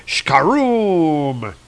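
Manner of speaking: drawn-out explosion noise made with the voice; imitated explosion. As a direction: draw out a big comic-book blast.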